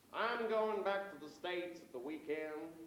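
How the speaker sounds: slow, laboured American drawl; bad American accent. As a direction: put on a poor American accent, slowly. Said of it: "that's my American accent, I'm afraid".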